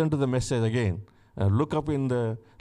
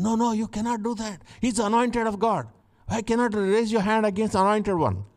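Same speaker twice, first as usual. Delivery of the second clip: David forbidding; 7 semitones higher, faster than usual. Retold or imitated. David forbids killing Saul.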